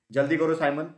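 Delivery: put-on loud call to the lagging child; mother mouse calling loudly to Simon. A narrator's reading. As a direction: raise the voice to hurry him. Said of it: "Mrs mouse चिल्लाई".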